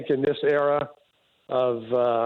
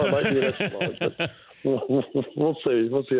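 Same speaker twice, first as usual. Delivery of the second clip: chuckling through the words; sheepish laugh while talking. Real, not acted. Laughing about maybe extra large.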